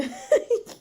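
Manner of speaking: loud high-pitched squealing laugh. Real, not acted. Streamer squeals at viewer's joke, high and loud.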